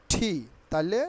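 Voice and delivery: ordinary delivery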